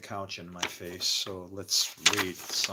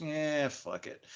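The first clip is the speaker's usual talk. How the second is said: drawn-out, higher-pitched shrug; mock indifference; 5 semitones higher, much slower than usual. Heart giving up during a heart attack, slow mock indifference. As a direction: give up with a slow, careless dismissal. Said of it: "Your heart just goes".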